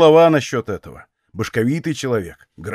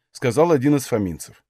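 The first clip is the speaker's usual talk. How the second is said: ordinary delivery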